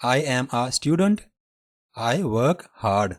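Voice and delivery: very slow, each word set apart; slow demo. Shown as a demonstration. Teacher reading example sentences slowly for students. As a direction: model the sentence slowly for learners. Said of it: "اس کو آئے ہی پڑھا جاتا ہے".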